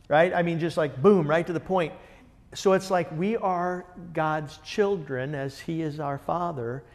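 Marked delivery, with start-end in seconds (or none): none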